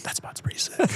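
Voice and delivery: hushed, voiceless retold aside; whispered echo. Retold friends later echoing him slyly.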